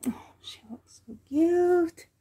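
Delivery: high breathy whisper of delight; cooing whisper. Real, not acted. Gushing over the doll to viewers, a high whisper. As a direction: whisper it like you're afraid to wake her.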